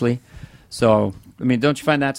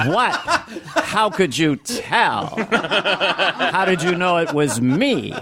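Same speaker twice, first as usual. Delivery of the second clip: slow laughing mock surprise; 6 semitones higher, much slower than usual. Mock Lovitz reaction.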